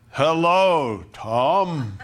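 a mock loud divine greeting; lightly hammed-up God speaking aloud; booming voice of God. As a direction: call out like a voice from heaven. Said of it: "doesn't speak in an audible voice like".

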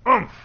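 short, high "oomph" grunt; effortful grunt. Shown as a demonstration. Preacher showing human effort in prayer, mock straining grunt. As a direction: punch out one straining grunt.

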